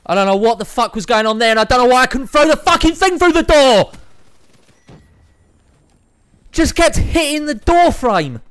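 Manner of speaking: yelling high and loud in rage; frustrated gamer screaming at the screen; furious shout. Real, not acted. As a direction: blow up at the game, screaming. Raging at the game's throw.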